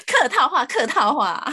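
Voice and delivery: high-pitched, through real laughter; laughing. Real, not acted. Co-host amused by the empty dinner promise, laughing. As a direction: say it while laughing, pitch jumping up.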